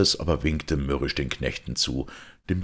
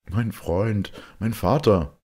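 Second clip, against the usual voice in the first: joyful cry; 7 semitones higher, 4 dB louder, slower than usual. A narrator's reading. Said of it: "rief Georg, indem er freudig errötete".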